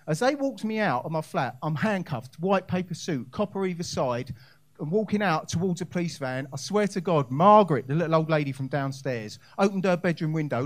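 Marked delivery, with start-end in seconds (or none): none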